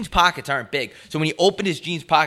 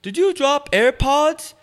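high, slow, stilted question; imitating odd stranger; 12 semitones higher, 4 dB louder, slower than usual. Podcaster imitating the car wash stranger asking about AirPods, odd. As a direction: put on an off-putting stranger, drawn out and raised. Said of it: "He sounded like this".

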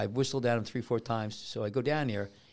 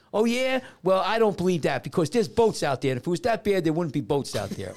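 put-on raised, louder child's retort, easing off; lightly mimicked challenging kid; 5 semitones higher, 4 dB louder than usual. Lifeguard quoting a kid doubting the ocean warning, mildly voiced challenge. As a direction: argue back like a cocky kid, lightly imitated. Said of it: "And the kid goes".

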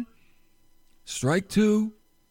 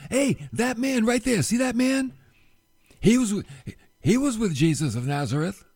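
put-on accusing servant girl; 7 semitones higher than usual.

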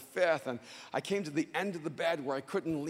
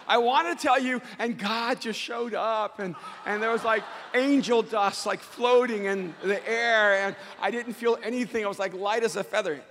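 hammed-up wonder, a little higher; mock miracle testimony; 6 semitones higher, 6 dB louder than usual. Preacher pretending God took the pain away, mock wonder. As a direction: gush lightly about a pretend miracle.

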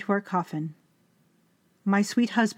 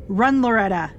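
loud warning cry; shout; 3 semitones higher, 6 dB louder than usual. Acted. Husband in agony warning his wife to flee, loud shout. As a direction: yell at her to get away. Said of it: "he shouted".

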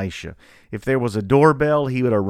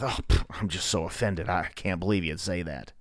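softer, sniffy put-on offense; mock offended whine; 5 semitones lower, 7 dB quieter than usual. Pastor mocking how people react to disagreement, sniffy mock offense. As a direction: mimic a touchy person, lightly hammed.

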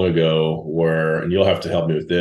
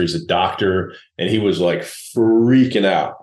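usual speaking voice

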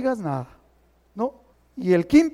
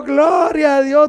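Gloria a Dios called out loud and slow; loud slow praise; 7 semitones higher, 9 dB louder, slower than usual. Retold or imitated. Preacher praising God aloud over the bill. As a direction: cry out thanks to God, drawn out. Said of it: "Y dije yo".

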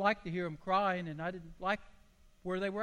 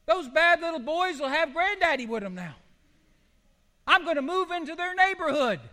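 louder, higher preaching cry; preaching at full force; 8 semitones higher, 6 dB louder than usual. Acted. Preacher proclaiming to the church, louder and higher. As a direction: proclaim it to the whole congregation.

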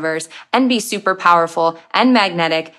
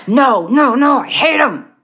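higher, louder flat refusal; stubborn refusal; 5 semitones higher, 5 dB louder, slower than usual. Pretend. Imagined bigot refusing to reconsider, in a loud stubborn voice. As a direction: dig in and shut it down hard.

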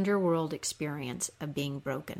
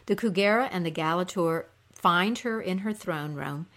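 ordinary delivery

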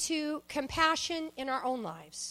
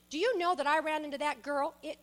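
ordinary talk, nothing marked

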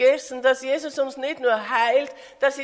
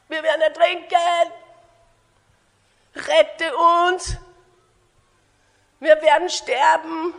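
higher, loud cries for rescue; panicked disciples; 4 semitones higher, 5 dB louder than usual. Acted. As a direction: cry out as if drowning. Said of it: "Sie haben Todesängste". (in Todesangst rufen)

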